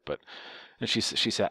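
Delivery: plain reading voice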